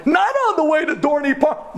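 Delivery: high-pitched incredulous plea; retold teenage wail; anguished protest. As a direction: protest to heaven in hammed-up despair. Acted protest to God at breakdown.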